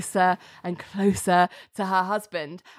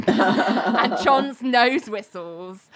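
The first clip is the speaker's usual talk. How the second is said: ordinary delivery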